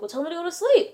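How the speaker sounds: mock-exasperated demand; impatient retort. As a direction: push back impatiently, pitch climbing to the end. Impatient texting exchange with the boy.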